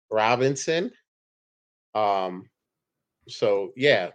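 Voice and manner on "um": ordinary talk, nothing marked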